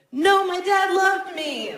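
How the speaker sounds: high, loud, defensive protest; mock defensive voice. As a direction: blurt it out defensively. Mock response in stand-up bit.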